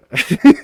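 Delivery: sudden high burst of laughter; high squealing laugh. Real, not acted. Laughing fit.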